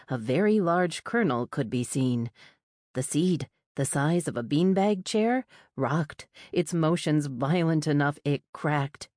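plain reading voice

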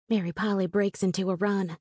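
plain reading voice

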